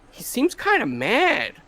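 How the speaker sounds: quoting a daughter in a lifted voice; lightly voiced daughter. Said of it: "But she's like".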